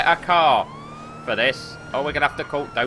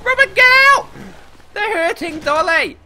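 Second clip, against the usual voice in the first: much higher, louder panicked yelling; urgent yell; 6 dB louder than usual. Real, not acted. To partner stuck in car during shootout, real panicked yelling. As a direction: yell at your partner to move now.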